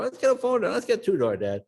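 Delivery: hammed-up young son pleading; begging teen. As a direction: push dad like an excited teenager.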